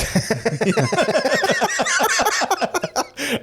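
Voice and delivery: long, high-pitched laugh. Real, not acted. Host cracking up at their own quoted words, laughing. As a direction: crack up for a few seconds.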